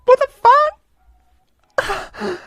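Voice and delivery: very high, loud, stretched out slowly; stunned shriek. Real, not acted. Streamer shrieks seeing the Reaper outside, high and slow. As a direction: shriek it in disbelief.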